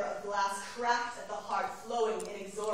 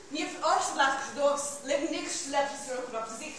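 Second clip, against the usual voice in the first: loud, higher-pitched declaiming in a strange tongue; invented-tongue declamation; 4 semitones higher, 5 dB louder than usual. Acted. Rose Lalonde introducing herself in a strange tongue, declaimed. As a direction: declaim it forcefully in an invented language.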